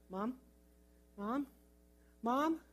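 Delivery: small, questioning call for mom; lost kid checking for a parent; tentative calling. Retold or imitated. Lost child calling for mother.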